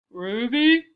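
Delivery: loud, questioning boom; big voice over the intercom; deep intercom voice. A narrator's reading. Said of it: "said the voice".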